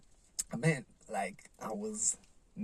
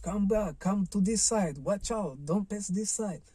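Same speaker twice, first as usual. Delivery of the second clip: raised, higher-pitched mock shouting; imitated lifeguard yell; 7 semitones higher, 5 dB louder than usual. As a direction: call out urgent orders like a lifeguard. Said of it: "the lifeguard, uh, yelling at me".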